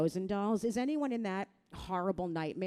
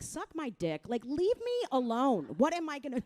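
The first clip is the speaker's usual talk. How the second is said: fed-up retort; 7 semitones higher, 4 dB louder than usual. Retold or imitated. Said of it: "I'm like".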